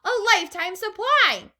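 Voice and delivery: put-on high, loud boy's voice; envious call. A narrator's reading. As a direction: call it out longingly. Boy in crowd calling out.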